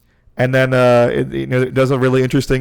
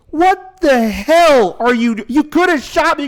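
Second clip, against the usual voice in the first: much higher, louder indignant shout; shrill outraged outburst at the mech pilot; outraged yell; 5 dB louder than usual. Retold or imitated. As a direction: scold him as if he nearly killed her. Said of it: "she looks and goes".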